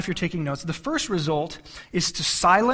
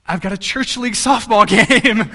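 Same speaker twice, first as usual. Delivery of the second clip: laughing answer; 4 semitones higher, 8 dB louder than usual. Retold or imitated. Teen answers the officer, laughing.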